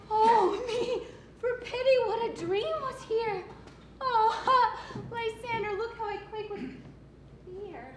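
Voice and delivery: frightened. Acted. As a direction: shaken and searching in fear. Hermia frightened after dream.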